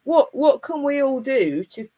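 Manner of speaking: ordinary talk, nothing marked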